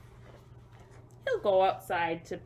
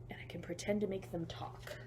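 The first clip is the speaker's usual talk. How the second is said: whisper; 7 dB quieter than usual. Real, not acted.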